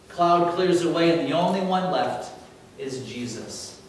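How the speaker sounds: normal talking voice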